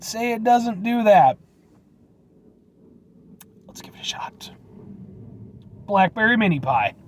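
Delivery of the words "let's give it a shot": hushed whisper before the bite. Acted. Whispering to viewers before tasting the pie. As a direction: whisper it, conspiratorial.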